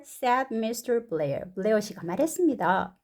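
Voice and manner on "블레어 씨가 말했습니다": ordinary delivery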